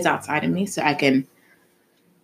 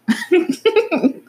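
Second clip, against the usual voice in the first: laugh; 14 semitones higher than usual. Real, not acted. Laughs at the therapist's trick.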